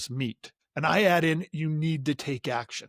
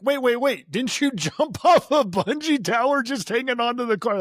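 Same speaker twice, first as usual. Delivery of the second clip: high, loud mock disbelief; raised-pitch, insistent double-take; incredulous listeners; 8 semitones higher, 6 dB louder than usual. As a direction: imitate astonished people interrupting, half laughing. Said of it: "they're like".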